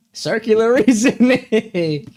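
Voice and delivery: high, drawn-out exasperated retort; mock exasperation. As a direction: stretch it out slowly in mock exasperation. Acted argument with his ex.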